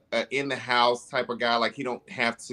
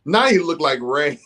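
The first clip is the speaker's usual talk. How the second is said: laughing; 6 semitones higher, 6 dB louder than usual. Real, not acted. Laughs while joking.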